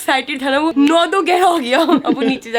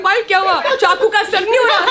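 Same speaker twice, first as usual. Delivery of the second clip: higher, laughing mock concern; laughing question; 7 semitones higher than usual. Retelling her question to Payal, laughing and higher. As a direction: ask through laughter. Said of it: "मैं कहा".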